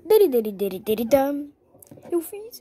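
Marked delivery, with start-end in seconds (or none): none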